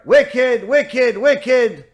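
loud, high parrot tirade; scolding parrot. Acted. Parrot rebukes the woman again next day, loud high mock scolding. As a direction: hurl each insult like a repetitive parrot, drawing some out.

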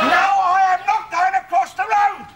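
fed-up bellow down the phone; angry refusal. Acted. Arguing with caller on phone.

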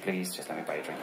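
the apologetic man's plea trailing off through a chuckle; fading laughing plea. Retold or imitated. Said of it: "And he said".

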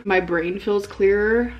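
usual speaking voice

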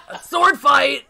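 laughing, loud, high sword-fight yell; excited shout. Retold or imitated. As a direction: blurt it out gleefully through a laugh.

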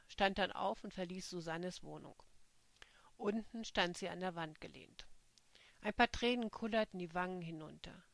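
ordinary delivery

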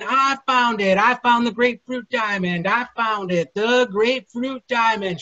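high, loud triumphant yell; excited yelling. Acted. Alligator lifts the diamond up to the crowd, yelling. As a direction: crow it out in triumph.

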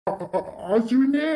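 stammer, then drag the name out; slow, stunned stuttering call; frightened stammer. Acted. Father faces his supposedly dead son, stammering in shock.

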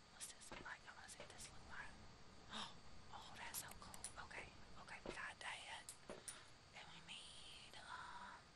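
hushed unvoiced whisper throughout. Real, not acted. Streamer whispering to viewers while sneaking around, whispered. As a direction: whisper like someone might hear you.

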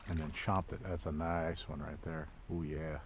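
normal talking voice

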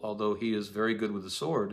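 ordinary talk

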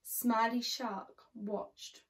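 ordinary delivery

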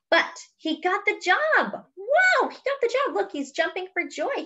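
high, delighted gasp of news; excited. Real, not acted. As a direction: share the good news sky high. Excited reveal to children.

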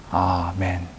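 usual speaking voice

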